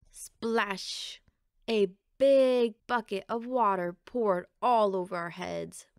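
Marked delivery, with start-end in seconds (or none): none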